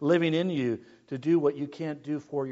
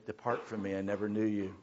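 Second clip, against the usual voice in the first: 1 low hushed judgment voice; 9 semitones lower, 8 dB quieter, faster than usual. Acted. God's verdict quoted in sermon.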